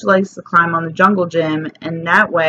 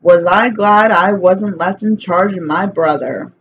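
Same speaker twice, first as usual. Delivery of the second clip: ordinary delivery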